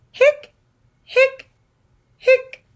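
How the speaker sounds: high squeaky hic hic hic; squeaky hiccups. Acted. Skeleton hiccuping to the kids, high squeaky hics.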